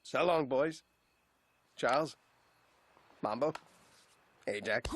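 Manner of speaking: low, sinking goodbyes with pauses; grave farewell. Acted. Father leaving his sons for exile, grave farewell.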